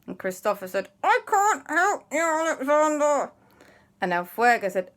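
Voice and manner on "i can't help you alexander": high, slow, strained reply; frozen in time. Acted. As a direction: answer through an immovable mouth. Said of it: "frozen in time like this".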